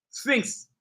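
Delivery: usual speaking voice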